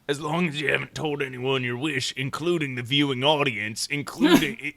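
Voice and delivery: a hint of the weird cowboy again; gruff cowboy voice. Mock cowboy speech continued.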